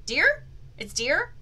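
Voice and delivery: usual speaking voice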